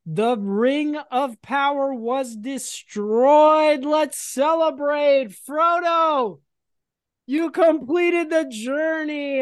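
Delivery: high, drawn-out grand announcement; mock-epic proclamation. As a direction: proclaim it like a joyous herald. Mock-epic celebration of Frodo's quest.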